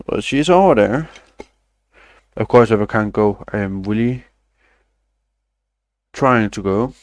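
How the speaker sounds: ordinary talk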